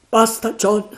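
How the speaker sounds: high, loud rebuke of a fellow sailor; frightened pirate snapping at John; sailor Mary. Acted. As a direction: tell John to stop, loudly. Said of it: "disse Mary".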